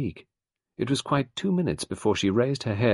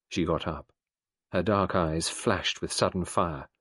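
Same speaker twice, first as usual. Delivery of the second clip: ordinary delivery, nothing marked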